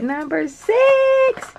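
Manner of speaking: high and slowly stretched "Number six"; excited announcement. Real, not acted. High stretched-out excitement. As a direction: she picks the next box for viewers, high and drawn out. Excited pick of next box.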